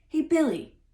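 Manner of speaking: loud hearty call, a touch nervous; hearty burst. A narrator's reading. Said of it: "bursted out Tom suddenly in a hearty".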